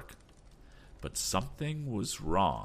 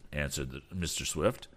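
ordinary delivery, nothing marked

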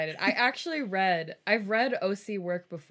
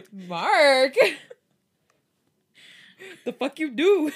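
mock outrage; 8 semitones higher, 8 dB louder, slower than usual. Real, not acted. Outraged at story character.

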